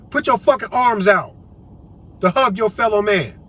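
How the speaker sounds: usual speaking voice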